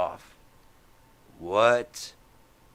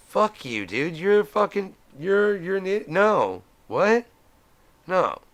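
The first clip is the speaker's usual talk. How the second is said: much higher and louder, yelling; mock outraged crowd; 12 semitones higher, 8 dB louder than usual. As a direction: scream at him in disbelief. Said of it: "everyone's like".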